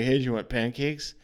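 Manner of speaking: ordinary talk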